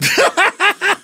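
high burst of laughter. Real, not acted. Amused laugh at the song lyric.

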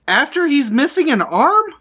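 high-pitched, teasing disbelief; squeaky-high mock query; incredulous joking question. Real, not acted. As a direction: ask it way up high, as a joke. Player jokes about the spell's result.

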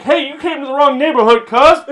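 loud, high hammed-up tough guy; mock street challenge. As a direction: playfully confront an intruder on your turf. Acting out an ambushing Pokemon.

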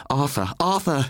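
high desperate calling out; wife calls after her departing husband, desperate; crying out. A narrator's reading. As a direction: call after him as he walks away. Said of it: "she cried".

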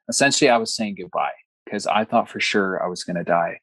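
normal talking voice

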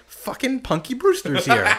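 high, giddy mock disbelief; high-pitched giddy exclamation; starstruck. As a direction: gush about the celebrity. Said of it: "I was like".

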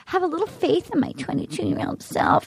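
soft, mock-pleading voice; imitating young contestant. Recapping a twenty-two-year-old asking for faith, soft mock plea. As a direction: plead quietly as the young woman. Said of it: "And then she's like".

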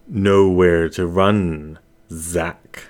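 slow creepy note from an unseen stalker; sinister taunt. Acted. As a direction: drag the words out like a threat in the dark. Threatening note read aloud.

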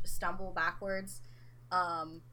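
usual speaking voice

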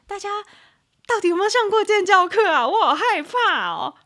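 loud, high exasperated cry with a laugh; exasperated outcry. Real, not acted. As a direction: throw up your hands at the audience. Exasperated at audience.

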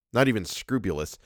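ordinary delivery

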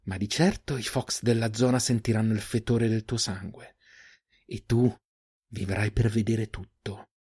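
soft threat, slowing at the end; low, hoarse menace. A narrator's reading. Huntress warns the bleeding man of Fox, quiet menace. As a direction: let the threat sink in quietly. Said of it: "La voce di Eirin era bassa, rauca, minacciosa".

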